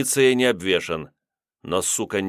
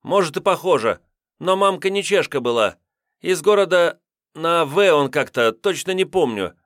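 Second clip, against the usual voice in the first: evasive; 9 semitones higher, 4 dB louder than usual. A narrator's reading. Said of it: "отпёрлась хитрая задержанная".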